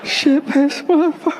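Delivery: put-on high child voice parroting the chant; tiny kid proudly repeating the curse words; toddler repeating. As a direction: squeak it back like a little kid. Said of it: "and then they'll be like".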